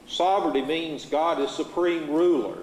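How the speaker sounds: usual speaking voice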